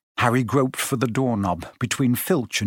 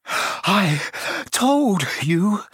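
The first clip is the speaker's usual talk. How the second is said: higher, gasping for breath; breathless and reproachful; 7 semitones higher, much slower than usual. Acted. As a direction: gasp out each word separately. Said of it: "Hermione gasped".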